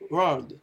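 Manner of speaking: world said as the "-rald" ending of emerald; syllable demonstration. Shown as a demonstration. Teacher explains A L, syllable demonstration of world. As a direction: model world as "rald", very light D. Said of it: "A L qui se prononce".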